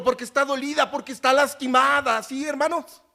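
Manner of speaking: rising pulpit fervor; fervent preaching. Acted. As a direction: preach it out to the congregation. Preacher retelling Gospel, rising fervor.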